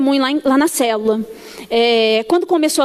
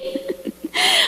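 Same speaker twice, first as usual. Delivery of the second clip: quick unvoiced laugh; a burst of airy laughter; short breathy laugh; 12 semitones higher, 5 dB quieter than usual. Real, not acted. Laughs at own imitation. (risada curta e soprada)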